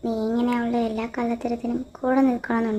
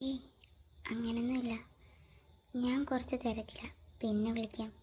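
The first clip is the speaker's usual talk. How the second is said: much softer, hushed voice; 12 dB quieter than usual. Acted. A girl wondering about a late call from Ram, hushed and subdued.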